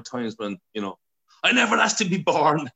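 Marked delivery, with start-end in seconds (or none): 1.4-2.7 put-on high, loud petulant teen outburst; sulky teenager. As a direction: fling it like a slammed door.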